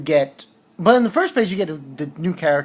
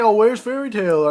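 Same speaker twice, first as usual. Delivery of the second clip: a lightly voiced onlooker asking, pitched up; mock questioning voice; 6 semitones higher, 5 dB louder than usual. Someone in the guild asks where Fairy Tail is, lightly voiced and higher. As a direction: toss out the question in a light put-on voice. Retelling a manga scene.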